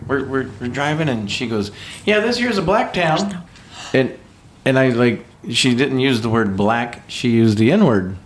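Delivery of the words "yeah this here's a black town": imitated old Southern lady, pitched up high; sweet Southern lady. Sweet Southern lady pointing out towns from the back seat, imitated high voice. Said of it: "sweet Southern lady".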